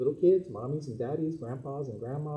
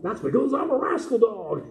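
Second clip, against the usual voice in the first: louder, higher puppet-dog repeat; loud puppy boast; 9 semitones higher, 8 dB louder than usual. Acted. Puppet dog repeats his boast to the minister, louder. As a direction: belt it out proudly. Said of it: "you're such a rascal".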